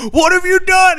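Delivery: high, anguished cry; anguished yell. Acted. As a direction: howl it, grabbing his lapels. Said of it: "He's like".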